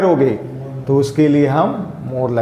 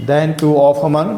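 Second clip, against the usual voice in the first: slow dictation; much slower than usual. Shown as a demonstration. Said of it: "dictating the topic words".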